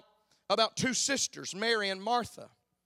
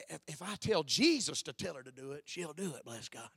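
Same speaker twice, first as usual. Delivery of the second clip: a hint of a sulky sister, lower and softer; mock Martha; 6 semitones lower, 4 dB quieter than usual. Preacher voicing Martha tattling to Jesus, lightly mocking. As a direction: play Martha tattling, dropping to a grumble.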